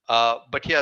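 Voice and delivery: usual speaking voice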